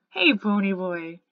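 loud, drawn-out hello; a booming slow hey; loud greeting. Acted. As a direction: call it out big and slow. Dally greets him loudly.

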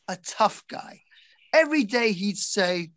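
usual speaking voice

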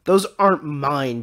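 drawn-out amazed denial; astonished. A narrator's reading. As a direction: protest in astonishment. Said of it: "Dandelion said in astonishment".

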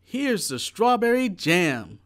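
high, loud eager shout. A narrator's reading. Jack back from the cellar with jam, a loud shout. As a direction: call it out with pride. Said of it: "shouted Jack".

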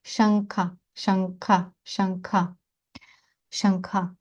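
Шанка with ng closed at the back before K; velar nasal. Shown as a demonstration. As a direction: drill Шанка slowly with a back-tongue nasal. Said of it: "на початку горла".

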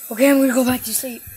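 usual speaking voice

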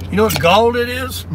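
usual speaking voice

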